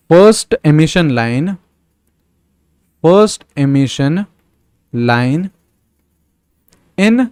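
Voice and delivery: word by word, very slow dictation; slow dictation of the exam question; slow question reading. Shown as a demonstration. Reads the exam question aloud.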